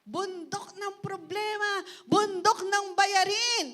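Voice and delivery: loud, high authoritative command; commanding shout. Shown as a demonstration. Preacher commands the mountain of problems to leave, loud shout. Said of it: "i-command mo, sabihin mo".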